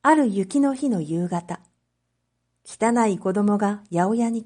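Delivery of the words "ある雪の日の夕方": ordinary delivery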